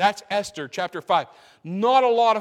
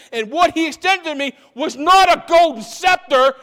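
raised, building preacher's cry; preaching at full force; 4 semitones higher, 6 dB louder than usual. Real, not acted. Preacher testifying how the king saw him in sin, full force. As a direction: preach it to the rafters.

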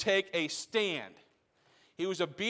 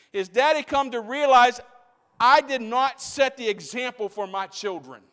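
loud, higher impassioned preaching; full-force preaching; 6 semitones higher, 8 dB louder than usual. Real, not acted. Preacher driving home the lesson to his church, impassioned. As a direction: press it on the congregation.

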